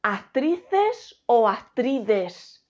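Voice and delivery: "Actrices" y "actrides" lentísimos; contrapón "Actrices" y "actrides", estirando cada sílaba; comparación didáctica. Shown as a demonstration. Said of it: "¿Qué decimos?". ("Actrices" frente a "actrides", deletreado casi por sílabas)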